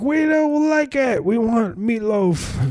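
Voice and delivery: very high, slow petulant whine; whiny old man voice. Retold or imitated. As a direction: whine it like a grumpy old resident. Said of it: "they were just like".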